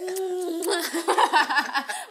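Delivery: loud, high burst of real laughter. Real, not acted. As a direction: crack up at her friend. Friend teases, both laughing.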